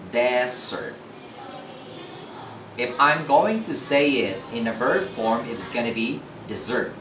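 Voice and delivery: usual speaking voice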